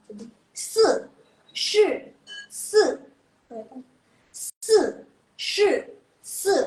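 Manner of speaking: se and shi hit high and loud, falling; tone drill with hand motion. Shown as a demonstration. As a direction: chop each syllable down hard. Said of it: "bantuan tangan atau kepala".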